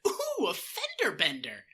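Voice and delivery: high, slow, sing-song delight; delighted surprise. Retold or imitated. Andy treating a fender bender as a treat, high delighted coo. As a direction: coo like you just found candy. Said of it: "his expression is like he found candy".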